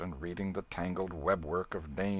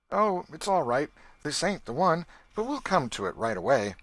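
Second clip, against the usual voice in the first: higher and louder, forced cheer; cheerily; 8 semitones higher, 6 dB louder than usual. A narrator's reading. Tom reassuring Becky in the maze, higher forced cheer. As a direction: brightly reassure a scared girl. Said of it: "he would say cheerily".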